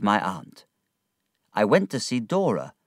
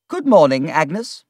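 put-on higher, louder brisk old aunt; an old aunt greeting her visitor, brisk; 8 semitones higher, 9 dB louder than usual. A narrator's reading. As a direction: hail her like a bustling old lady.

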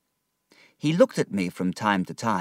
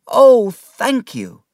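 higher, louder, slow heartfelt thanks; overjoyed thanks; 12 dB louder, much slower than usual. Acted. David thanking his aunt again, overwhelmed. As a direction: pour out the gratitude.

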